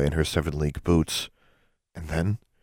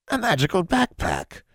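high, delighted exclamation; light up with greed; surprised; 14 semitones higher than usual. A narrator's reading. Hag spotting a magic backpack, surprised.

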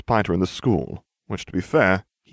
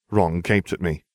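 ordinary delivery

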